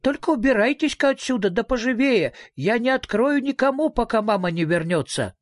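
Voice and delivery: put-on high, bossy little girl; high child's voice giving orders. A narrator's reading. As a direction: shoo them off in a small high voice. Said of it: "ответил голосок".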